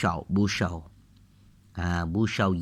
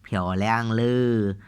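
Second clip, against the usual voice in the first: slow model pronunciation; much slower than usual. Shown as a demonstration. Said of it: "Piaoliang ni cantik".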